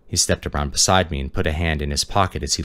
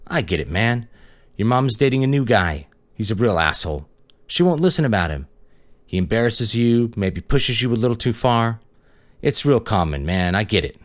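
put-on young detective pushing hard, voice raised and high; pushy detective; 6 semitones higher than usual. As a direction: lean on the kid to confess.

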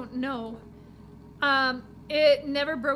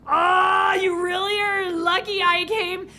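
higher, louder emphatic speech; loud driver line; 4 semitones higher, 6 dB louder than usual. Acted. Driver telling her she's lucky, louder and higher. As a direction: announce it boldly.

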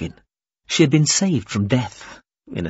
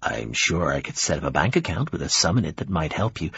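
low, formal offer; measured, lowered placating tone; conciliatory; 6 semitones lower, 3 dB quieter than usual. Acted. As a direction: propose help gently in a lower voice. Formal visitor offers compensation.

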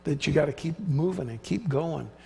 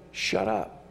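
much lower, softer shut up; firm inner rebuke; 10 semitones lower, 4 dB quieter than usual. Retold or imitated. Believer silencing the inner doubting voice, low and firm. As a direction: hush the doubting voice in your head. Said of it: "we gotta say".

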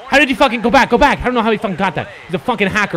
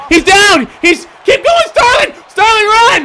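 high, excited shouting to a runner; high-pitched, urgent cheering on; frantic urging; 6 semitones higher than usual. Real, not acted. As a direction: yell at the runner to keep going.

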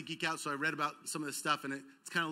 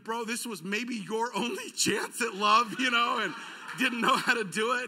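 high, loud talk breaking into laughter; laughing; 5 semitones higher, 7 dB louder than usual. Real, not acted. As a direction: exclaim it through an amused laugh. Preacher laughs at his own example.

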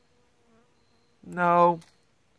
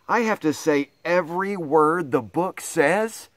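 ordinary delivery, nothing marked

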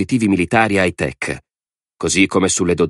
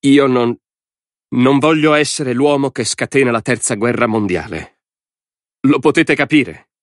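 higher, strained-effort protest from a president; urgent, high-pitched presidential appeal; effortful plea; 7 semitones higher, 3 dB louder than usual. A narrator's reading. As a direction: start loud, break off, then insist with effort. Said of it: "Con uno sforzo disse".

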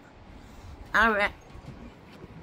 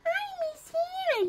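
tiny high, soft whine; pretend crying bear; 5 dB quieter than usual. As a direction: whimper as a sad little toy bear. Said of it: "don't cry".

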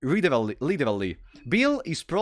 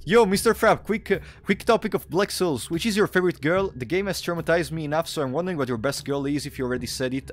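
ordinary talk, nothing marked

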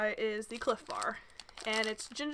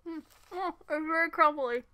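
talking through a bite; mouth full, chewing; 5 semitones higher than usual. Real, not acted. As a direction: hand over full mouth. Seen 0: bitten waffle in hand, chewing, then hand covers mouth.

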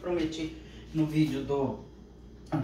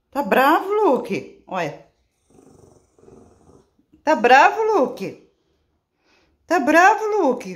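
high, loud teasing question; 11 semitones higher, 11 dB louder, slower than usual. Real, not acted. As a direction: tease the dog sweetly. Falando com o cachorro. (provocando o cachorro com voz aguda)

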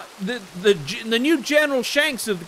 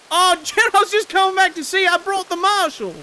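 eager; 8 semitones higher, 3 dB louder than usual. Acted. Greeting the general eagerly.

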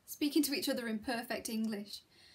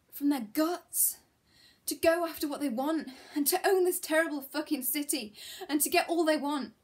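impassioned; 4 semitones higher, 7 dB louder than usual. Acted. Impassioned speech to the class.

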